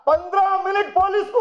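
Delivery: mocking quote. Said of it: "అట".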